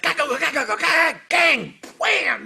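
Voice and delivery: high, rattling percussion noises; imitated percussion. Trumpeter mimics the percussion section, clattering vocal imitation. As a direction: bang out the percussion parts with your voice. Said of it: "you know, hitting percussion".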